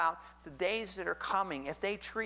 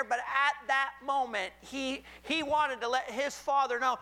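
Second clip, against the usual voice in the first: higher and louder, climbing with passion; preaching at full force; 6 semitones higher, 4 dB louder than usual. Acted. Preacher urging the congregation, fervent and loud. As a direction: build to a fervent preacher's cry.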